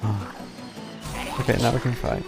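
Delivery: ordinary talk, nothing marked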